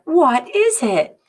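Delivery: loud, slow curious question; hammed-up curious vendor. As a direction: ask like the eager vendor. Vendor asks about the smell.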